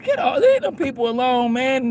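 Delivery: ordinary talk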